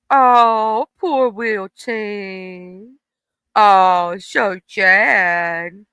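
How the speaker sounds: slow, drawn-out fake sympathy; mocking "poor Wilton" in fake sorrow; mock pity. Shown as a demonstration. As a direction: pity them sarcastically to needle them. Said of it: "and laugh at 'em".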